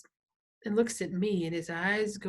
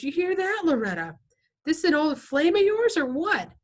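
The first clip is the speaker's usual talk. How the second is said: higher, louder jeering tough guy; mocking biker; 9 semitones higher, 7 dB louder than usual. A narrator's reading. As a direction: taunt them, laughing at them.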